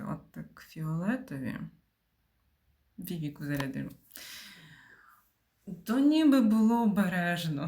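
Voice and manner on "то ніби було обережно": louder, higher, exclaiming. Real, not acted. Streamer reacting to her own move, with a loud high exclaim. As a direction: call it out in disbelief.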